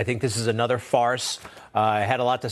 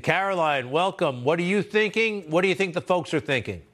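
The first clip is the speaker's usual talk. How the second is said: ordinary talk, nothing marked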